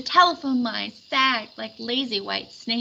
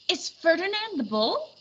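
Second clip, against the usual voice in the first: a hint of a higher kid voice; lightly voiced boy; 8 semitones higher than usual. A narrator's reading. Fernando guessing at the sculpture, lightly voiced higher. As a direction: ask it like a curious boy.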